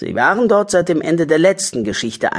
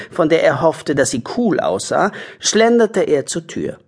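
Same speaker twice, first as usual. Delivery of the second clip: plain reading voice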